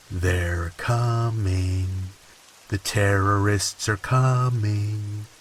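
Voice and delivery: very slow, drawn-out sing-song; creepy sing-song. Retold or imitated. Husband murmuring to wife after a kiss, creepy sing-song. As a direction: lilt it slowly like a lullaby. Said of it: "in a really creepy sing-song voice".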